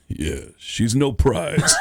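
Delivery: slow, drawn-out deadpan comic impression; Rodney Dangerfield. Comic running down his bride as people sit, slow deadpan. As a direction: drop the insult slowly after the applause.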